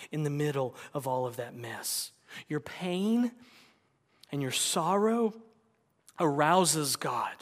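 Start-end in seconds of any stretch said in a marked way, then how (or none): none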